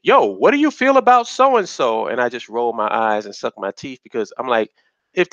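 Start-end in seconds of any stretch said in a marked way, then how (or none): 0.0-2.1 high, put-on voice of someone asking; high mocking impression of people asking; mock asker. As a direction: mimic a pushy fan, pitched up. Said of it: "when people come to me and they say".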